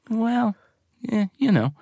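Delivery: high, hesitant mock disappointment; disappointed, retold. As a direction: imitate a reluctant, let-down reply. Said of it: "you sound a bit disappointed".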